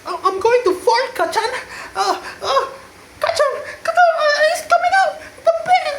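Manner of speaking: high laughing screech about farting; cartoonish shrieking panic through laughter; squealing high cartoon voice; panicked anime boy. Voicing anime character panicking.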